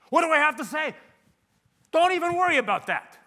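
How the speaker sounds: loud, high exclamations; emphatic acted exchange. Salesman acting out client worry and brush-off, loudly. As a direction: belt it out in mock alarm, then dismissal. Acting out anxious question and reassurance.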